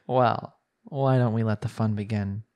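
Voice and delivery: ordinary delivery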